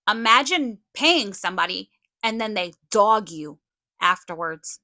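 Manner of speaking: telling them off, full force; angry callout. Real, not acted. Furious callout at Kim and Lisa.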